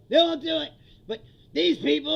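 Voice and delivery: mock Trump rambling loudly about farm workers. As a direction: boom it out like a blowhard.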